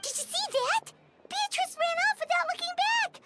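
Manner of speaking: high-pitched, astonished exclamation; startled, high-pitched reaction; shocked onlooker. Acted. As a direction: blurt it out in astonishment. Onlooker witnesses friend running off.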